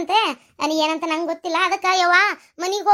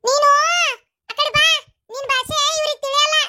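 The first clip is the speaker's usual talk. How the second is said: bossy dismissal; 8 semitones higher than usual. Acted. Orders companion out of the way.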